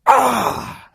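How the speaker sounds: sudden high, loud cry of exasperation; frustrated yell. Retold or imitated. After the coffee spills, a high exasperated yell. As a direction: blurt it out like the last straw. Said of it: "And I'm just like".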